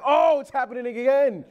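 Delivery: high, loud comic wail of dismay; exasperated dismay. Retold or imitated. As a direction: cry out as if it's all starting over. Retells despair as daughter starts crying.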